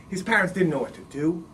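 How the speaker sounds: usual speaking voice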